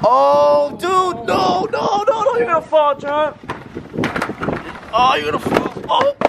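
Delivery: high panicked yelling; alarmed shout. Real, not acted. Friend about to fall.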